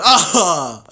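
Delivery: loud high "ah" laugh burst; sudden high-pitched cackling laugh; burst of laughter. Real, not acted. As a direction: crack up loudly at a joke. Laughs at funny chat comment.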